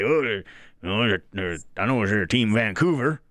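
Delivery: hammed-up, bad Texas accent; amateur impression of a Texas guy; terrible Texas accent. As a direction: put on an unconvincing Texan voice. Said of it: "That's my Texas accent".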